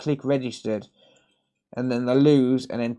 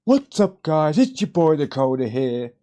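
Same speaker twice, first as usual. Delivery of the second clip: higher, louder hyped vlogger greeting; cheesy YouTuber intro; 4 semitones higher, 6 dB louder than usual. Pretend. Repeating the intro to viewers in a higher, louder hyped voice. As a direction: overdo the cheesy YouTuber hype. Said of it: "I'd punch my fucking self if I talked like that".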